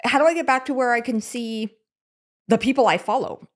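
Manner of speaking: lightly imitated mom, a bit high; mom's real voice. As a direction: imitate your mother without the old-lady caricature. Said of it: "that's more what she sounds like".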